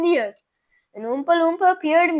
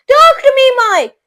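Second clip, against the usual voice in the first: high, loud desperate plea; begging him to answer; crying out; 12 semitones higher, 15 dB louder than usual. A narrator's reading. Mother cries out to her shrunken son Mike. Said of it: "cried Missus T V".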